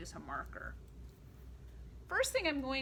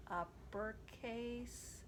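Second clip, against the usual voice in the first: slow soft voice; 6 dB quieter, much slower than usual. Shown as a demonstration. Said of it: "uppercase and lowercase chant".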